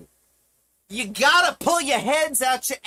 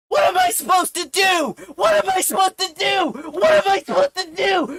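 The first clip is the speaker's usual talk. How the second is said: higher, frantic repeated plea; desperate shouting; 4 semitones higher than usual. Real, not acted. Streamer begging viewers for answers, desperate shout. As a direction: cry it out, more frantic each time.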